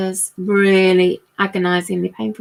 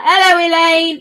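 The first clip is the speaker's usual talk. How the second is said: higher, louder mock greeting in his accent; David's accent; 6 semitones higher, 5 dB louder than usual. Guessing how he arrives on the call, imitated accent. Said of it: "in his accent, not in my accent".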